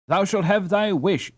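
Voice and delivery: high, mock-grand fairy decree; playful solemnity. Annie as fairy godmother granting Hans his wish, mock-grand. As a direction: pronounce it like a fairy queen. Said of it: "with playful solemnity".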